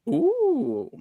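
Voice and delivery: ordinary talk, nothing marked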